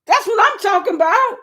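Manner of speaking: high, bright-voiced hello to a viewer; high excited shout-out; excited greeting. Real, not acted. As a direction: hype up the viewer as you greet him.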